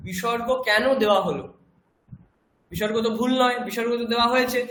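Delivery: usual speaking voice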